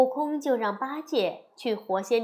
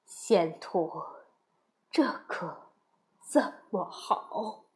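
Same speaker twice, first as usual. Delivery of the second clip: low, halting, fearful monk; frightened pleading with long hesitations; trembling plea; 4 semitones lower, 4 dB quieter, slower than usual. A narrator's reading. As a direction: beg helplessly, breaking up the phrases. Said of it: "哀求道".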